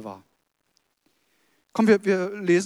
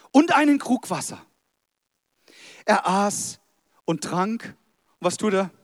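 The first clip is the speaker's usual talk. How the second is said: story narration; 4 dB louder than usual. Acted. Reads Bible story aloud, playful additions. (dramatisch vorgelesene Geschichte)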